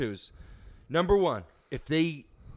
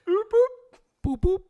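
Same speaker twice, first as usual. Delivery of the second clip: 1 radio beep imitation; 7 dB louder than usual.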